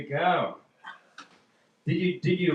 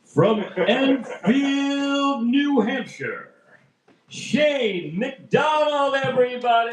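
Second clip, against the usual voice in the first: slow, booming intro for the next act; radio announcer voice; 4 semitones higher, 6 dB louder, slower than usual. Shown as a demonstration. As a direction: stretch it out like an old radio host. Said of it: "working on my radio announcer voice".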